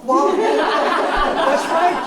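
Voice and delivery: drawn-out, high words through a laugh; laughing at being teased. Real, not acted. Laughs at teasing.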